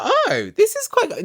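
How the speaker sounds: high breathy-free impressed "oh"; impressed gasp. Retold or imitated. Vlogger retelling her own impressed reaction, high awed oh. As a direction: pitch the oh way up, awed. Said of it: "I was like".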